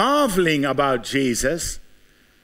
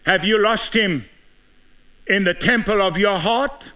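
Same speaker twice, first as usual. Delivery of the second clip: preaching at full force; 6 semitones higher than usual. Acted. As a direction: press each question on the congregation. Altar-call style questioning.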